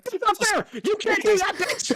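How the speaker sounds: high squeaky screech of outrage; shrill-pitched cartoon imp scolding; squeaky angry imp. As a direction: yell like a furious little trickster. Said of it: "He's like".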